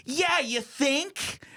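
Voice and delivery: high-pitched, drawn-out sarcastic comeback; mock snotty attitude. Fallen girl answers a stranger's warning, mock snotty attitude. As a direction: imitate an embarrassed girl snapping back, very high. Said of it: "before you get in any attitude".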